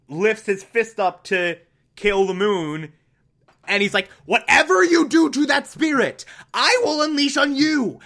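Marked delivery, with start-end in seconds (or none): 4.2-8.0 much higher, louder threat, hammed-up rage; furious shout. Iroh threatening Zhao over the moon spirit, a furious shout. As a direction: bellow the threat like an enraged uncle. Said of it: "seething with rage".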